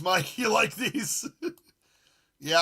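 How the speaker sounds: ordinary talk, nothing marked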